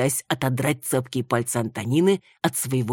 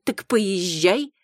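slow, tragically dismissive; tragic dismissal; 6 semitones higher, 3 dB louder, much slower than usual. A narrator's reading. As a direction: wave her off dramatically. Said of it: "отмахнулась Тоня с самым трагическим видом".

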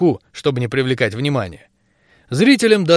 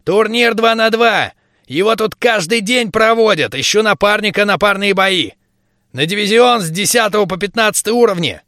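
excited gnome; 6 semitones higher than usual. A narrator's reading. Gnome pitches the arena excitedly.

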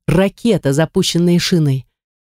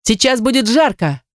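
high-pitched urgent warning; calling out; 8 semitones higher than usual. A narrator's reading. Shina warns everyone in the shuttle, calling out. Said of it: "крикнула Шина".

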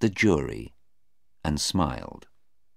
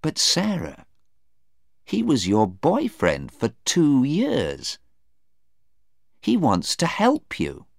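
lightly voiced, higher-pitched persuasion; pleading mother; 10 semitones higher, 3 dB louder than usual. Mother reasoning with Sarah about Stephen, pleading. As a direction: gently urge on a raised pitch.

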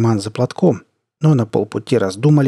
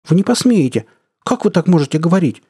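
higher, louder indignant cry; indignant outburst; 7 semitones higher, 4 dB louder than usual. Acted. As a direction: cry out in outrage. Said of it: "возмутилась в очередной раз девушка".

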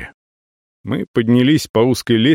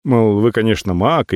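usual speaking voice

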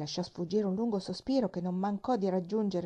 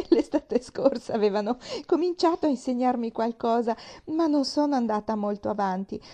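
still laughing; 4 semitones higher, 7 dB louder than usual. Acted. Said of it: "Pollyanna scoppiò in una risata".

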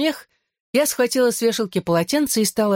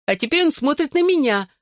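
high squeal of fright; 10 semitones higher than usual. A narrator's reading. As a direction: squeal it, scared of the cat. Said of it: "взвизгнула Клэр".

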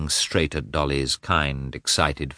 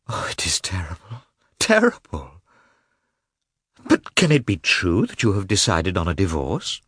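Dolly horrified; 2 semitones higher than usual. Acted. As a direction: breathe out the shock, then ask.

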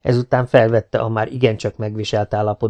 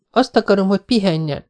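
put-on insistent young woman; 9 semitones higher than usual. A narrator's reading. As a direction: refuse to let him go. Jessica insists. (határozott, erélyes női hang)

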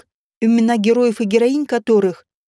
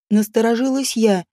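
ordinary delivery, nothing marked

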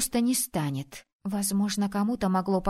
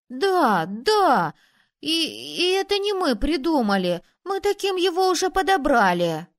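put-on high, louder boyish voice; protesting boy; 6 semitones higher, 5 dB louder than usual. A narrator's reading. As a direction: insist like a kid defending himself. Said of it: "заявил рыжий мальчуган". (мальчишка громко оправдывается)

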